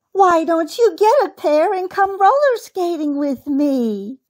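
put-on high, eager child-bunny voice; little rabbit girl. A narrator's reading. Rabbit girl inviting uncle to skate, high and eager. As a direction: invite brightly in a small girl's pitch. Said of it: "laughed the little rabbit girl".

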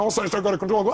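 normal talking voice

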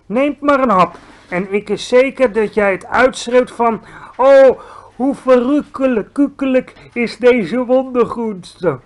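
hoge, levendige reuzenstem; a giant enthusing over vegetables; friendly giant. A narrator's reading. GVR voice, lively. (vrolijke reus die groente aanprijst)

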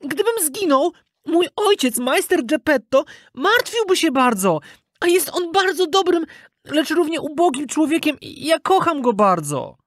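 high-pitched, earnest begging; pleading puppet boy. A narrator's reading. Puppet pleading for father's sake, high begging voice. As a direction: plead for your life as a small child. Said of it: "Wzruszył się błaganiem Pinokia".

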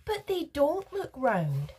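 slow, high-pitched protest; stubborn objection. A narrator's reading. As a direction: dig in, stretching each word. Veruca objects in a quarrel.

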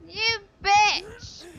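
high, loud furious shout. Real, not acted. Streamer cursing the enemy who killed him, furious high shout. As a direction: spit the insult in real rage.

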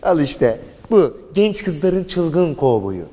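drawn-out, savouring each word; slow and grand, like an announcer; slow mock promo. Presenting the wild cowboy to girls, slow announcer voice.

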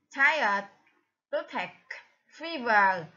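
Tired, toothache, fever, each stressed at the start and falling away; first-syllable stress. Shown as a demonstration. Teacher lists matching words, Tired, toothache, fever stressed on the first syllable. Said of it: "the first stress".

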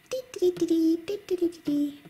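high, sing-song dee calls; a high little fawn's chirping; baby deer call. Acted. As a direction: squeak like a tiny fawn. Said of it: "I'm a deer, a baby deer".

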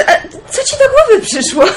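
high, amused disbelief; tickled by the crazy idea; a laughing how did you think of that; laughing surprise. Acted. Girl amazed at friend's visit.

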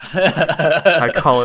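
laughing. Real, not acted. Teasing friend while laughing.

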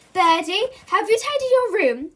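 high, loud frantic mother; fussy mum voice. Acted. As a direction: fuss at him like a harried mum. Mum nagging before viewing.

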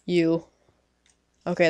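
ordinary delivery

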